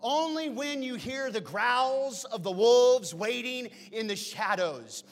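loud, high, driving sermon build; preacher thunders about chaos; preaching hard. Acted. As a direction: preach it with full force.

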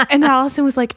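ordinary talk

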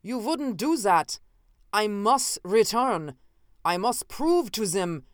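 slow, loud, frantic begging; panicked protest while struggling; desperate pleading. Acted. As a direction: plead as if your life hangs on it. Captive pleads to return home.

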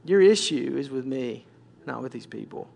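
low, soft and measured rebuke; hushed, grave authority; stern, still small voice. Acted. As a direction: correct them quietly but firmly, with long pauses. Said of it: "very sternly just speak to my heart".

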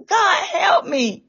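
high, drawn-out plea to God; crying out. Retold or imitated. Dreamer at rope's end pleading with God, crying out. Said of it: "And I cried out".

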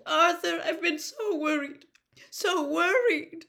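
high, loud, trembling relief; a frightened mother clinging to her husband; Molly frantic with worry. A narrator's reading. Mother to husband after the attack, frantic and high.

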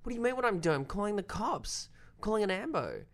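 high, urgent disbelief; incredulous outburst. Retold or imitated. Actor snaps back at director while calling police, high and urgent. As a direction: fire back in alarmed disbelief. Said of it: "And he went".

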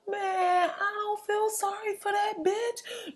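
high, soft, drawn-out dismissal; mock whine. Real, not acted. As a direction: whine it slowly in a tiny high voice. Mock-whiny rejection of the sympathy.

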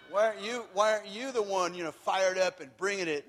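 hammed-up demanding church member; mock loud scolding. Church member pressuring his wife to lead worship, mock loud scolding.

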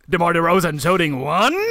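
rising end on "one?"; mock announcer asking, "one?" swept upward; questioning announcer. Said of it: "när det är and one".

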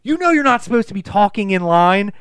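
high, loud scolding; imitated shrieked telling-off; mock screaming teacher. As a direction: reprimand like an outraged schoolteacher. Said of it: "screaming at him".

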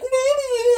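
very high blubbering wail; mock hysterical babble, high-pitched; imitated freak-out wail. Nanny freaking out on the phone, imitated high wail. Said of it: "The baby's crying 'cause I'm like".

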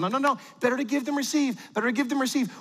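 quick, high-pitched repeated saying; hurried platitude. Acted. Parent getting kids to the car without meltdown, hurried. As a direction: rattle it off to cut off protest.

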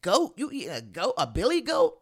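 high, loud mock disbelief; a playful imitation of an appalled friend; shocked friend. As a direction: act out the friend gasping at goat. American friend shocked at curry goat.

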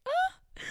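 sudden high yelp of realisation; a shocked high cry; surprised gasp. Real, not acted. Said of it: "I was fully using a Kiwi accent".

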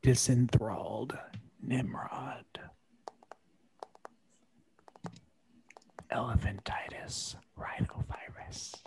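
soft mock-sexy whisper; hushed, pretend-sultry reading; OnlyFans voice. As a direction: whisper it seductively into the mic. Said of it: "in your best OnlyFans voice".

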